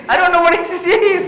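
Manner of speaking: high, loud laughing confession; laughing admission. Retold or imitated. Student admits to the officer he has no idea, laughing. Laughing retelling his reply.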